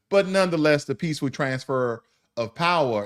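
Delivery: ordinary talk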